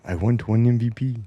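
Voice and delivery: lightly put-on French accent, whiny; a hint of a French accent, begging for the trophy; mock French accent. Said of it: "with my French accent".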